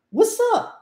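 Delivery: "What's up?" with T and P dropped, said as os-a; casual what's up. Shown as a demonstration. Teacher demos how natives really say it. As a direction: swallow the T and the P in "What's up?". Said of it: "Engole o P".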